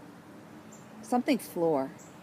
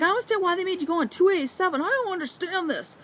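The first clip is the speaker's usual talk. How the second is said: higher, fast, puzzled husband; 11 semitones higher than usual. Retold or imitated. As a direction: rattle off the husband's confusion. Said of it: "he's like".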